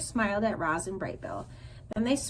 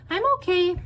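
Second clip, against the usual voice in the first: high gosling voice; 9 semitones higher, 7 dB louder than usual. A narrator's reading. Gosling after crying.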